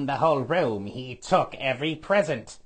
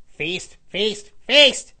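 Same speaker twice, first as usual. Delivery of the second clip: building to a shout; 8 semitones higher, 13 dB louder, much slower than usual. A narrator's reading. Mocking the endless feasting.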